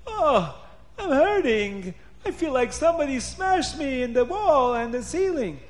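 high-pitched aching complaint; hammed-up pained whining. A narrator's reading. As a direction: moan theatrically to the guards. Battered Paroi complains to guards.

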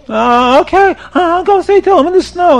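high, loud mock wail; crying penitent. Sinner pleads with the rabbi, hammed-up crying. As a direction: wail like a desperate, guilt-ridden man. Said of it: "the man is crying and he's screaming".

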